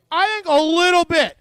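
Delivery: high and loud, comically emphatic; emphatic sarcasm. Real, not acted. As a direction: belt out the understatement for comic effect. Mock understatement about Moses' anger.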